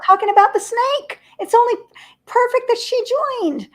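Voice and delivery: high, loud, thrilled burst; delighted. Real, not acted. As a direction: bubble over with joy that she arrived. Delighted as guest appears.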